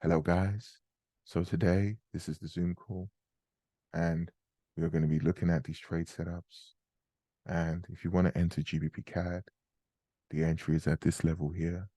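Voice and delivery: lightly put-on, measured phone manner; hint of a polite call voice, softened and spaced with long pauses; telephone voice. Shown as a demonstration. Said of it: "I've got my telephone voice".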